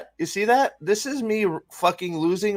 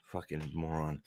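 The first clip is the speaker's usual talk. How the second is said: disgusted brother; 11 semitones lower, 18 dB quieter than usual. Retold or imitated. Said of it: "at him and is like".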